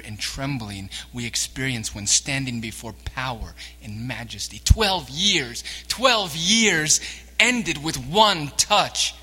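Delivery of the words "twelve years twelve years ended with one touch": loud, slow and ringing, the second twelve stretched out; "twelve years" hammered home from the pulpit; preaching emphasis. Real, not acted. Preacher to the congregation, loud and slow.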